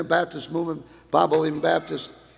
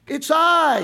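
voice of God; 9 semitones higher, 5 dB louder than usual. Acted. God voiced calling to Israel.

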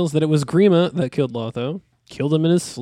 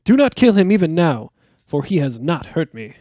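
firm plea; 4 semitones higher, 7 dB louder than usual. A narrator's reading. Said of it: "said Frodo".

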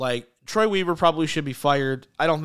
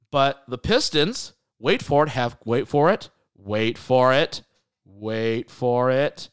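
slow teasing wait for it; drawn-out suspense build; slower than usual. Acted. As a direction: stretch out the suspense. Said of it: "There's a lot of waiting".

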